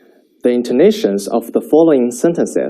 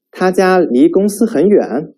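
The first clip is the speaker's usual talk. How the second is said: 他家离公司很远 with lifted pitch at 远; question tune; 6 semitones higher than usual. Shown as a demonstration. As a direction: ask it again, ends up. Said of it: "pay attention to the intonations".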